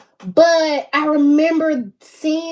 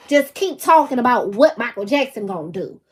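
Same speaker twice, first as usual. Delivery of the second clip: ordinary delivery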